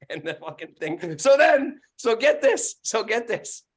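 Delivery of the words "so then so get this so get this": high, loud excited lead-in; worked up. Real, not acted. Landlord tells co-host the next twist, excited raised voice. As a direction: burst into the next part of the story.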